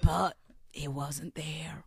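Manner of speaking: slow, soft, half-whispered cliffhanger; slow right down and drop to a hush; mock-dramatic hushed storytelling; hushed suspense. Suspenseful end of the told story.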